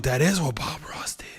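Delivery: breathy whispered aside. Real, not acted. Host realising the Bob Ross link, whispered. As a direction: let it out in a hushed whisper.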